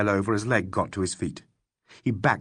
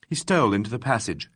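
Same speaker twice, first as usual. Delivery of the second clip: ordinary delivery, nothing marked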